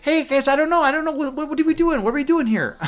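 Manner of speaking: flustered high voice, unsure what's going on; nervous Alex Grey voice. Retold or imitated. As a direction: blurt it high and fretful. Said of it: "the guy's like".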